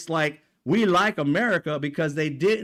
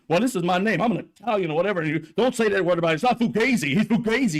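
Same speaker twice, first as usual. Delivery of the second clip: mock angry Cuomo; faster than usual. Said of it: "You were saying all that shit, angry and shit".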